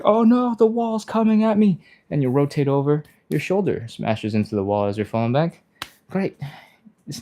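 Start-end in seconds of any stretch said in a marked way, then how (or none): 0.0-1.8 high, loud playful alarm; mock panic. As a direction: cry out as the wall looms. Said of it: "you're like".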